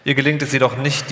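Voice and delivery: ordinary delivery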